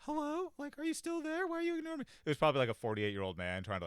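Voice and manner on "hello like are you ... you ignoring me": very high-pitched needy voice; pestering caller. Retold or imitated. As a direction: act out someone pestering for a reply, way up high. Acts out a pestering message.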